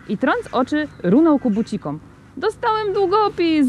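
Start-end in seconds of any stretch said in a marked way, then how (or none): 2.4-3.7 put-on very high, drawn-out squeal of a little boy; delighted yelp. A narrator's reading. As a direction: yelp with joy over a present. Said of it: "zaskowyczał". (piskliwy, przeciągły skowyt radości)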